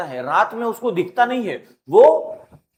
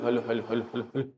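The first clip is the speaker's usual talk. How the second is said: fading echo; 9 semitones lower, 10 dB quieter, faster than usual. Shown as a demonstration. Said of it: "बार बार परावर्तन के कारण".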